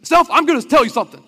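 rally yourself out loud like a coach; fired-up self-command. Retold or imitated. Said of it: "So he says".

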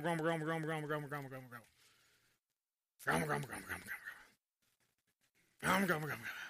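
low muttered grumble fading away; sinking, indistinct grumbly murmur; grumbling. Shown as a demonstration. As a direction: mumble "grumble" over and over under your breath. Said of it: "simply means how it sounds".

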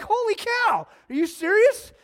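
very high, loud astonished exclamation; stunned amazement. Retold or imitated. Hearing the ex paid to slash his tires, loud high astonishment. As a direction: react with total disbelief. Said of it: "I was like".

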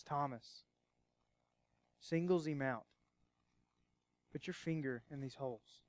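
soft, low, tender words to a doubter; gentle reassurance. Acted. Jesus gently inviting doubting Thomas, soft and low. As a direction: hush right down and coax him to believe.

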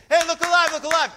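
loud, high call of look alive over and over; shouted coach's call from the dugout; coach yelling from the dugout. Retold or imitated. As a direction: bark it across the field to wake the players up. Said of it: "once you hear your coach say".

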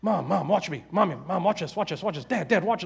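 imitating a kid's nonstop, insistent calls; pestering kid. Kid on the playground begging parents to watch, pestering. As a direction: nag for attention like a child, words tumbling out. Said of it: "what do they always say, like, nonstop".

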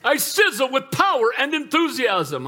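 hammed-up motivational chant; mock the hype man with a grin; laughing parody of a pep talk; mocking rah-rah speaker. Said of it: "This guy gets up there and he said".

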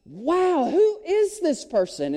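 high, wondering exclamation; amazed crowd. Acted. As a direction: marvel at who this could be. Crowd marvels at the miracle.

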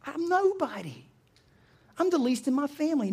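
high-pitched, mildly acted objection; self-deprecating protest. Gideon protests he is unfit.

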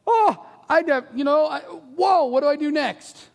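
high, loud flustered stammer; flustered panic. Pretend. Preacher voicing Peter's panic, high and flustered. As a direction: act out a man who has no idea what to do.

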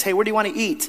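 a hint of the wife, asking lightly; wife's voice.

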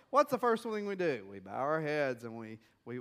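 ordinary talk

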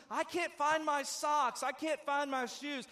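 high, slow mock whine of a child; whiny kid. Parent mimicking a child's Sunday morning complaint, whiny. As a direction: drag it out like a kid who lost his socks.

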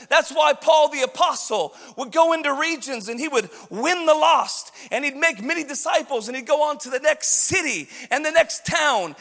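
ordinary talk, nothing marked

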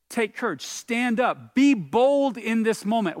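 rally them, pushing harder with each phrase; rallying call. Acted. Preacher voicing Jesus urging disciples to be bold, rousing call.

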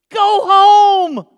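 loud, high, irritated shout; aggravated. Acted. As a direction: holler at a pest to leave. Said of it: "He's done got him aggravated".

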